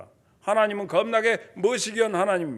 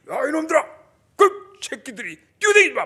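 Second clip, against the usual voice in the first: put-on loud, higher shout at the kids; stern father yell; 5 semitones higher, 7 dB louder than usual. Preacher imitating a strict father yelling at his kids. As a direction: bark at the children like a strict father.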